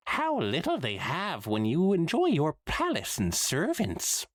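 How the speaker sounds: high, hammy Trottingham spirit voice; a hammy Trottingham ghost, pitched high; bad Trottingham accent. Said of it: "the bad Trottingham accent it had been using".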